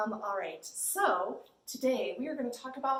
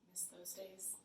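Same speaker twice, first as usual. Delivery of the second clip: wistful whisper; whispered aside; 3 semitones lower, 19 dB quieter, slower than usual. Real, not acted. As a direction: sigh it to yourself. Wistful aside about summer.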